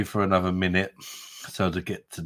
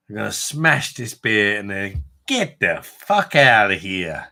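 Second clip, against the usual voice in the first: put-on playful, louder New York accent; a jokey New York tough-guy twang, louder; 5 dB louder than usual. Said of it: "a bit of a New York twang on it".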